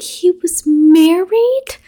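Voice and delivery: high, slow, stunned rising question; shocked question. Retold or imitated. Noah learning the dead man had a wife, shocked. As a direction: stretch it out in total disbelief. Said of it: "And Noah's like".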